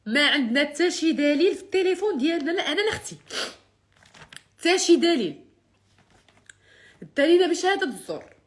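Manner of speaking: loud, high, tearful outrage; angry crying. Real, not acted. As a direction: cry out in anger. Indignant protest of innocence.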